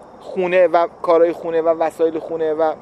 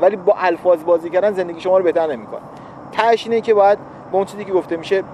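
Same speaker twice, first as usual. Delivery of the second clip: ordinary delivery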